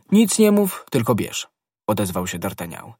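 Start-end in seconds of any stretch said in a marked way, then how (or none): 0.1-1.5 high, loud opening order; brisk command. A narrator's reading. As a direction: cut off the objection, starting high and forceful. D'Artagnan cuts off Aramis's protest.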